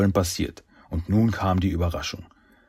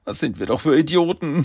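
high, exasperated cursing; 8 semitones higher than usual. A narrator's reading. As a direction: curse your own stupidity. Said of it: "fluchte Michelangelo". (aufgebracht fluchend)

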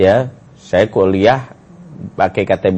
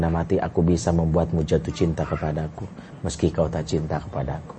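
low, soft recited pledge; retold inner vow; 6 semitones lower, 9 dB quieter than usual. Brother silently vows to win his sister's love, hushed vow. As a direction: quote it softly and low, like a private promise. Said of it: "Saya cuma bilang".

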